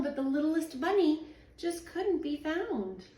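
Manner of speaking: ordinary delivery, nothing marked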